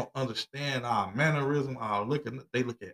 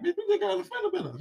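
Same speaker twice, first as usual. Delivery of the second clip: much higher, fed-up mock snap; retold mad. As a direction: brush her off, mildly mock-annoyed. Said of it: "he was mad".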